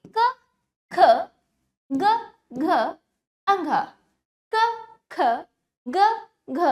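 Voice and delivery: क, ख, ग as separate short syllables; consonant drill. Shown as a demonstration. Teacher leading children through consonants, careful drill. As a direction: recite each consonant crisply, pausing after it. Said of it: "मेरे साथ अच्छे से इनका उच्चारण करेंगे".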